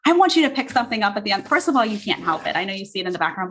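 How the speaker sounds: ordinary talk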